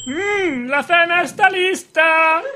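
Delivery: high singsong dinner call; calling kids to dinner. Acted. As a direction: call the family to the table. Sketch mother calls out dinner.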